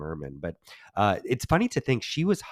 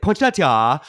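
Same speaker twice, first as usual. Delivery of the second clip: exaggerated Jamaican-accented POINSETTIA, higher and louder; mock Jamaican accent with wildly misplaced emphasis; mimicked Jamaican accent; 7 semitones higher, 10 dB louder, slower than usual. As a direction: belt out a wild imitation of a Jamaican accent. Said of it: "Can you try to mimic how he says poinsettia".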